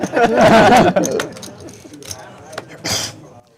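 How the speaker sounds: loud laugh trailing away; long laugh. Real, not acted. Commentator amused by the hand, laughing out loud. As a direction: burst out laughing, then let it fade.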